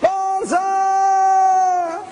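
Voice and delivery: high, loud ceremonial shouts; yelled banzai. Retold or imitated. Crowd on the summit greeting the sunrise, loud ritual yells. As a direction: cheer it to the rising sun. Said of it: "watching them all yell".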